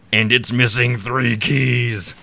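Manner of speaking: very slow, loud devilish gloat; Satan voice. Acted. Satan delivering the next catch, gloating. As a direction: stretch it out loudly like a villain. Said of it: "Good Satan voice".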